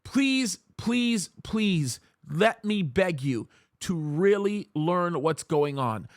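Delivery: normal talking voice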